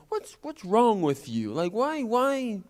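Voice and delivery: high, prying schoolkid voice; curious kid demanding answers; taunting kid. Retold or imitated. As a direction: needle him like a nosy classmate. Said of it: "a kid came up and was like".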